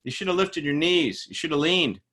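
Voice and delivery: loud, high scolding outburst; scolding coach. Retold or imitated. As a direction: berate the runner right at the finish. Coach scolds tired runner.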